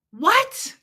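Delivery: baffled, yelped question; confused what. Retold or imitated. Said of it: "he started to make me go".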